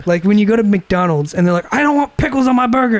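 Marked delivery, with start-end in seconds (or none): 1.7-3.0 higher-pitched imitation of a fussy customer; mock demanding complaint. Imitated customer at McDonald's refusing pickles, mock demanding. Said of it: "anything else other than yelling at me".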